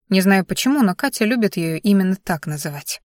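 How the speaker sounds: plain reading voice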